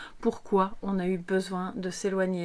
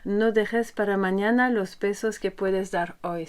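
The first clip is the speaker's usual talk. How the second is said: Spanish proverb read in a put-on Spanish accent; French speaker's Spanish accent; 4 dB louder than usual. Shown as a demonstration. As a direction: read the Spanish line with careful Spanish sounds, a little louder. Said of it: "ne vous moquez pas de mon accent espagnol".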